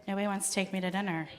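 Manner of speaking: ordinary delivery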